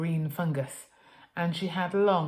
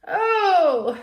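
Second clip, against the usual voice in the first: very loud, high shocked oh; scream; 13 semitones higher, 12 dB louder than usual. Acted. Mother peeking at the filth, screaming. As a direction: shriek at the sight. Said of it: "screamed Mother".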